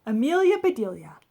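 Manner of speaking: plain reading voice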